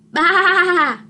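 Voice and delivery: loud, bleating baa; sheep baa. Acted. Sheep refusing, loud bleating baa. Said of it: "said Sheep".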